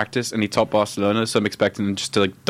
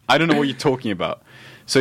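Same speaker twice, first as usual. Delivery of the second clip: ordinary talk, nothing marked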